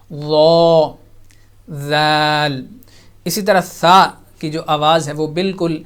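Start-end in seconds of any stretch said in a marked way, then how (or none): 0.1-0.9 thick, full-mouthed وا; وا voiced full and heavy; heavy full letter. Shown as a demonstration. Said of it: "یہ soft ہے اور پر ہے". 1.7-2.7 light, thin ذال sound; ذال said fine and light; thin soft letter. Shown as a demonstration. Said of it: "ذال soft ہے باریک ہے".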